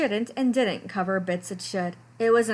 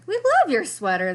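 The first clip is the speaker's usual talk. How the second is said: louder, bubbly clown voices; enthusiastic clowns; 5 semitones higher, 6 dB louder than usual. A narrator's reading. Clowns praising Lester's sweater, bubbly. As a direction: gush like delighted clowns.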